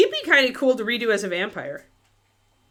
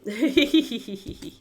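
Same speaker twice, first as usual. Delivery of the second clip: long giggle at normal volume; real, easy giggle; giggling; 3 semitones higher than usual. Real, not acted. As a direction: giggle in a quick run. Laughs at chat.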